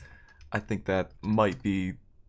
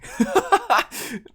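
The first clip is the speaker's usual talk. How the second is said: a high, loud burst of laughter; shrill embarrassed laugh; 9 dB louder than usual. Real, not acted. Embarrassed by praise.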